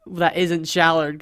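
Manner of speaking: usual speaking voice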